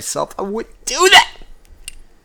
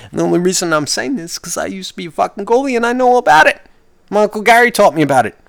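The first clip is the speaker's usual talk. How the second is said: rushed aside; 8 semitones higher, faster than usual. Real, not acted. Rushed aside about being goalie.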